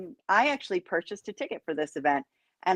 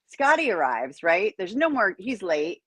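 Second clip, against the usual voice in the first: normal talking voice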